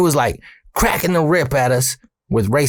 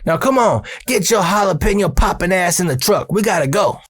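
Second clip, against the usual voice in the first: higher-pitched bossy impression; imitated boss ordering, pitched up; Earl hurrying; 7 semitones higher than usual. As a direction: hustle a worker into the truck. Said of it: "and he says".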